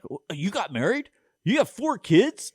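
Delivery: incredulous, pitch leaping up high; stunned, high-pitched disbelief, hammed up; mock astonishment. Acting out friends reacting to card.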